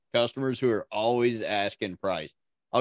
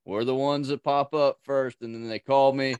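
ordinary talk

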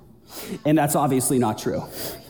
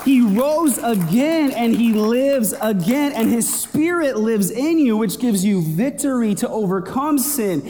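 building preaching; 5 semitones higher than usual. Acted. Preacher rallying the church toward victory, rising fervent preaching. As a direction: lift the congregation, rising fervour.

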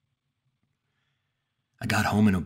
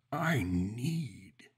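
gasping monster; 4 semitones higher, 3 dB quieter than usual. A narrator's reading. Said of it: "it gasped".

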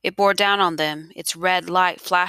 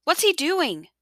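high-pitched, alarmed question; alarmed cry; 10 semitones higher, slower than usual. A narrator's reading. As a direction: ask in panic, pitch leaping up. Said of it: "Isabel's mother cried".

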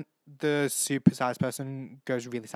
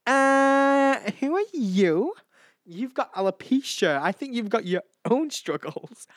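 sassy comeback; 10 semitones higher, 5 dB louder, slower than usual. Pretend. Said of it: "I'd be like".

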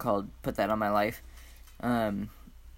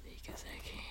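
cheeky whisper; 17 dB quieter than usual. Real, not acted. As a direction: hush it playfully. Whispered reply.

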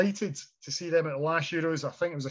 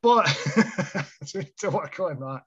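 ordinary talk

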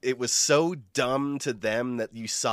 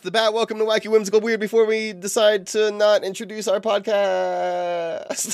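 higher, louder show-opener delivery; announcer-style intro; 10 semitones higher, 3 dB louder than usual. Pretend. Host opening the podcast, mock announcer intro. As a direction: open the show big, stretching the final word.

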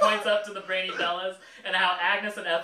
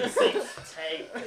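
put-on southern accent; 4 semitones higher, slower than usual. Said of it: "weird southern accent".

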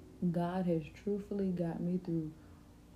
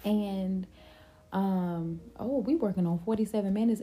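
ordinary talk, nothing marked

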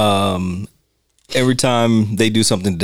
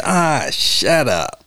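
drawn-out, very slow mock dismissal; imitated brush-off; 3 semitones higher, much slower than usual. Podcaster mimics how they dismiss the guy, scornful. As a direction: stretch it out like a Three Stooges put-down. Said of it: "Like, the way that he does it".

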